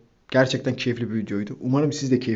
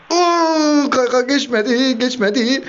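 much higher, louder, slow stretched cry of disbelief; near-miss yelp; 11 semitones higher, 5 dB louder, much slower than usual. Real, not acted. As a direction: wail it as the ball fails to cross. (yüksek perdeden uzatılmış şaşkınlık çığlığı)

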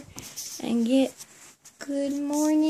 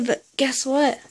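ordinary talk, nothing marked

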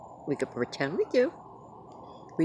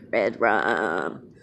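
Shining voice; 4 semitones lower, 6 dB louder than usual. Retold or imitated. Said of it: "like in The Shining".

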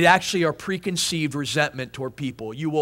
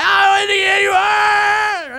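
loud, high, drawn-out mock wail; stretched-out screaming whine, hammed up; bawling toddler; 13 semitones higher, 7 dB louder, slower than usual. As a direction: imitate a child's full tantrum. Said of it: "she begins to cry".

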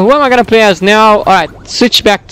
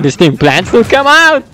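ordinary talk, nothing marked

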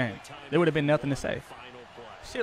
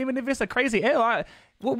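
ordinary talk

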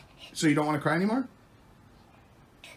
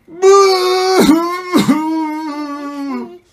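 loud high-pitched fake wail; drawn-out playful boo-hoo cry; mock crying; 10 semitones higher, 10 dB louder than usual. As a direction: pretend to bawl, long and falling. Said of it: "I'm gonna cry, then Georgia, you cry".

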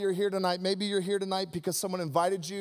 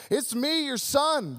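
high, eager elf voice; excited Buddy the Elf; 5 semitones higher, slower than usual. Retold or imitated. Buddy the Elf meeting his dad, high and eager. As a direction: burst out like a hopeful child. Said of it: "Buddy's like".

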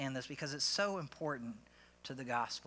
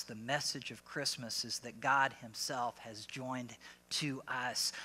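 ordinary delivery

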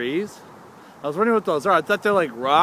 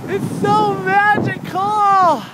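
ecstatic shout; 13 semitones higher, 4 dB louder, much slower than usual. Acted. Hamming up snowy dock.

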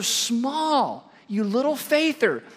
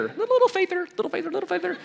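high teasing sing-song, much higher; Jesus teasing the disciples in a high mocking singsong; 8 semitones higher, 5 dB quieter, much faster than usual. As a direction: taunt it like a playground chant. Mocking sing-song of the coined word.

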